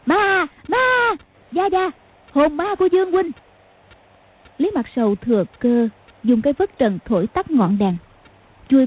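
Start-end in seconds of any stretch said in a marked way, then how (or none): 0.0-3.3 high-pitched cry of terror; frightened calling out. A narrator's reading. Khúc Cô hiding behind the grandmaster, frightened high cry. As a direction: wail it high, scared of a ghost. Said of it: "kêu to".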